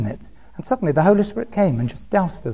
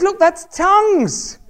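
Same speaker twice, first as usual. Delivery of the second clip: excited insistence; 9 semitones higher, slower than usual. Retold or imitated. Excited revelation.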